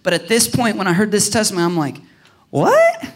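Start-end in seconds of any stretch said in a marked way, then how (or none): 2.5-3.1 high, loud incredulous "what"; shocked. Acted. Preacher reacting to what she said about God, shocked. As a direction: burst out in disbelief.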